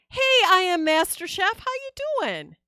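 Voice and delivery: high, loud hammed-up chef host voice; mock TV chef greeting. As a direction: greet them like a showy TV chef. Streamer greets chat as character.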